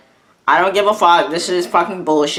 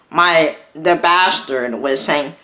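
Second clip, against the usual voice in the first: usual speaking voice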